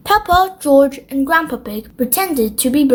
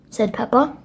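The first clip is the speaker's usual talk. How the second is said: usual speaking voice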